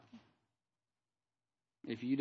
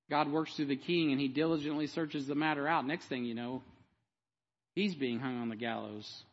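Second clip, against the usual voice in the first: normal talking voice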